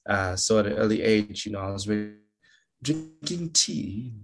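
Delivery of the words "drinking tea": slow, higher, lightly put-on British accent; mock British. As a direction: savour each word like a proper little Brit. Childhood in the UK.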